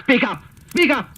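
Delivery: sharp impatient order. Acted. Officer barks at hesitant lieutenant.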